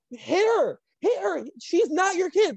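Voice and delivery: high-pitched imitation of egging someone on; mock urging. Bryce egging Jazz on to hit, high urgent mimicry. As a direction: urge him on in a high voice.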